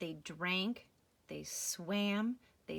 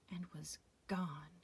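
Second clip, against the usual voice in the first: whispered; 5 semitones lower, 8 dB quieter than usual. Acted. Sad ending whispered.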